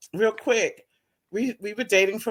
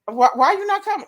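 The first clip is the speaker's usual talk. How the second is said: high pleading quote; 11 semitones higher, 4 dB louder than usual. Retold or imitated. Voicing the suitor begging her out, high and pleading. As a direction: pitch way up, pleading why.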